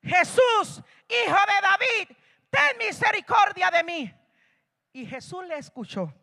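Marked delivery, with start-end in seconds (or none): 0.0-4.1 high, loud desperate cry; shouting louder. Acted. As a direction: yell the plea over those hushing him. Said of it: "Y él gritaba más fuerte".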